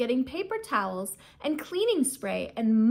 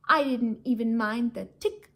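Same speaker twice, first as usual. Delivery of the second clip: ordinary delivery, nothing marked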